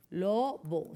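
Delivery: "Lobo" in two low, separate beats; split into syllables. Shown as a demonstration. Teacher showing how to separate it, "Lobo" syllable by syllable. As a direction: say "Lobo" as Lo, pause, bo. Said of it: "vamos a separarlas".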